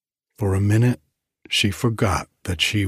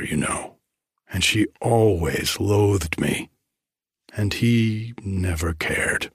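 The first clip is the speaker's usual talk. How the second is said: ordinary delivery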